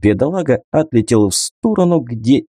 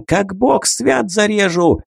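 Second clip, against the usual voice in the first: frantic threat; 10 semitones higher, slower than usual. A narrator's reading. Tikhon threatens the hostage.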